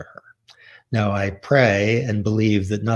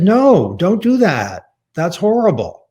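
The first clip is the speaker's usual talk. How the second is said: louder and higher, imitating a furious mother; mother's angry scolding; 8 semitones higher, 6 dB louder than usual. Mother forbidding her son to study feminism, mock angry scolding. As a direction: scold your son hard, hammed-up mother. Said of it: "my mother could be brutal when she was angry".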